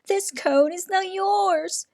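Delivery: high, squeaky rival claim; high squirrel voice. A narrator's reading. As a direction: insist the cone is his. Squirrels fighting over cone.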